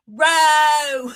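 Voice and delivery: high, loud, sustained yowl; mock scream. Host imitating screaming woman from a gif, loud mock scream. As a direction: belt out an exaggerated imitation screech. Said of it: "And she's screaming".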